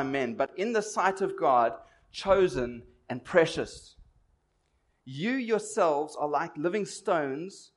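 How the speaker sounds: slow, solemn Bible reading; scripture reading. Acted. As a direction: read the verses slowly and solemnly. Reads the Bible passage aloud.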